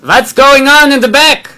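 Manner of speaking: put-on rabbi's heavy Polish accent, called high and loud; thick Polish accent. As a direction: call to the back of the hall in a Polish accent. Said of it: "in his thick Polish accent".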